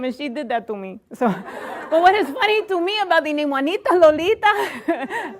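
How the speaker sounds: put-on lively comic Spanish accent. Comedian joking about her name, in a put-on Spanish accent. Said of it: "I don't talk like that".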